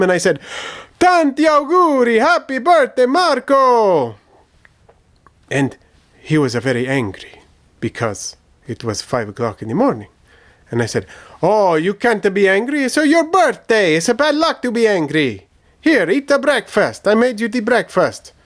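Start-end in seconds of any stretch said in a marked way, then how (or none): 1.0-4.2 much higher, louder sing-song greeting; excited birthday cheer. Retold or imitated. Bursting into his brother's room at dawn, a loud excited birthday greeting. 11.4-18.3 eager coaxing. Retold or imitated. Coaxing angry brother.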